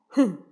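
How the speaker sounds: loud annoyed humph; displeased humph. Acted. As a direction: grunt in displeasure. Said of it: "不高兴地哼了一声".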